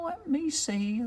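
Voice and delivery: high, soft witch voice pondering; musing witch. Acted. Witch concentrates.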